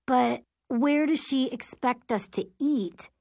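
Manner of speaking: high-pitched indignant question. A narrator's reading. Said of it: "River asks indignantly".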